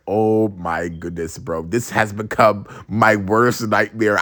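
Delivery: low, slow mock despair; weary dismay. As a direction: sigh it out, defeated and drawn out. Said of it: "And I was like".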